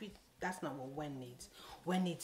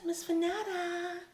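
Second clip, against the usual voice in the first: much higher, louder, slow drawn-out name; 12 semitones higher, 8 dB louder, slower than usual. Retold or imitated. Addressing the stylist, drawn out and pointed. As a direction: stretch her name out pointedly.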